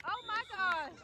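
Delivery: normal talking voice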